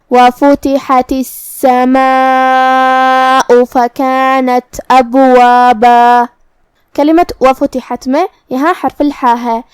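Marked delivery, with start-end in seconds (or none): 0.1-6.2 slow, drawn-out tajweed chant; measured qari recitation; Quran recitation. Shown as a demonstration. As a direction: recite each word melodically, unhurried. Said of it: "teacher recites next verse".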